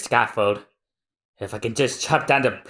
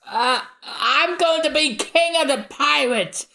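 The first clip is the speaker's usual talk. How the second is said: much higher, crying out; Luffy's scream; 14 semitones higher than usual. Acted. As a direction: yell it to the whole crowd. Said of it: "Luffy just screams".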